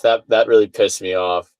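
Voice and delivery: normal talking voice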